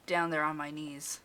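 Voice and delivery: ordinary delivery, nothing marked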